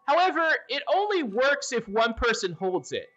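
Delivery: high, chirpy gargoyle explaining the rules. Acted. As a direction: play the gargoyle, cheerful and helpful. GM voices the gargoyle.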